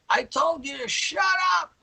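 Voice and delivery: high, strained shout; angry yell. Retold or imitated. Bandleader shouting the order a second time. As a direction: snap back even harder. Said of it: "I'm like".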